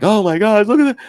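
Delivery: high, loud mock amazement; mock excitement. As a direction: squeal it like a hyped-up buyer. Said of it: "they're like".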